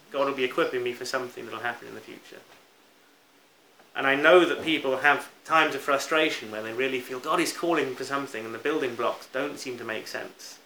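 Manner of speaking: normal talking voice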